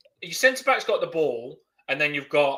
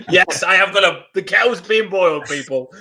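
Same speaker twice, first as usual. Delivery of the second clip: louder, laughing through the words; 4 semitones higher, 8 dB louder than usual. Real, not acted. Admitting to viewers the kettle's on, laughing. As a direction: still cracking up, half-speaking. Laughing about mum bringing tea.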